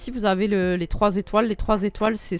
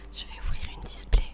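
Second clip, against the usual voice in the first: close, breathy ASMR whisper. Pretend. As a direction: whisper it right in the ear like ASMR. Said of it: "Je vous fais un A S M R ouverture".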